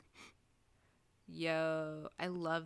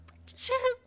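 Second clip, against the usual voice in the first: fake crying wail; 11 dB louder than usual. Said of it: "that's my best attempt at crying".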